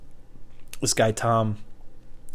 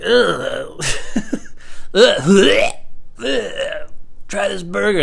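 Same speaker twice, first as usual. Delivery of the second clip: disgusted groan; 8 semitones higher, 5 dB louder, much slower than usual. Pretend. Disgusted burger ad parody.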